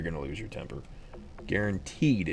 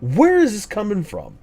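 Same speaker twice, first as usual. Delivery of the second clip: much higher, loud incredulous yelling; outraged disbelief; 12 semitones higher, 6 dB louder than usual. Retold or imitated. Parent yelling at son over rocks in keyhole, outraged disbelief. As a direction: shriek it like you can't believe your eyes.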